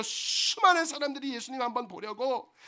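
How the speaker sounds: ordinary talk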